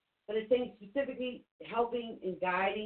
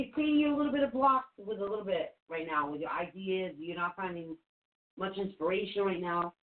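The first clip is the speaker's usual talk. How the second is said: usual speaking voice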